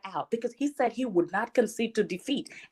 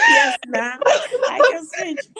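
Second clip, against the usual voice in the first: light Nigerian accent, high and loud; 7 dB louder, slower than usual. Shown as a demonstration. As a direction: slip into a playful Nigerian accent. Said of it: "I can switch to my Nigerian accent now if you want".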